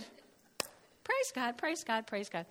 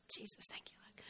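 breathed softly to herself; whispered prayer; 22 dB quieter than usual. Real, not acted. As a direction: whisper a quick thanks.

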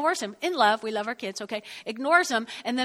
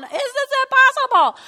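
high, loud mock wail of despair; hammed-up crying plea; mock bawling; 13 semitones higher, 10 dB louder than usual. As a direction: ham up a tearful tantrum. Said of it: "crying and bawling".

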